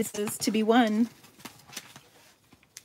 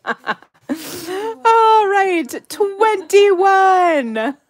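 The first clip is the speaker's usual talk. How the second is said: laughing; 11 semitones higher, 7 dB louder, much slower than usual. Real, not acted. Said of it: "Who's dying laughing".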